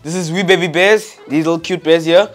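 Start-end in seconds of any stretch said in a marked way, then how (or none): none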